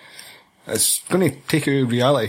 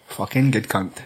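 ordinary talk

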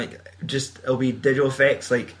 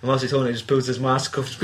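ordinary talk

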